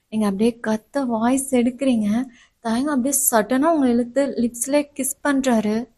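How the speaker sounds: ordinary talk, nothing marked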